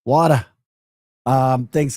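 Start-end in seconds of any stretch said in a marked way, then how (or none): 0.0-0.5 louder, higher New York WADA; New York accent. Retold or imitated. Host trying the New Yorkers' word, New York accent. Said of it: "all these New Yorkers".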